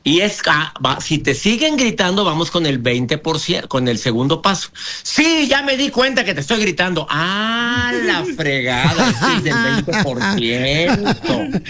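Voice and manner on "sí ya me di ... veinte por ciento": high-pitched mock yelling; hammed-up angry comeback; imitating a shouter. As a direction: play the stubborn yeller, voice jumping up, then dragging it out. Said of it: "sigue gritando".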